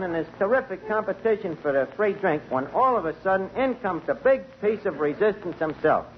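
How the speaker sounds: ordinary talk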